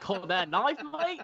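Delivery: laughing through a hammed-up Australian accent; a half-hearted Crocodile Dundee Australian accent. Host asks him to do his Australian accent, so he laughs through it in Australian. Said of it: "I gotta do an Australian accent now".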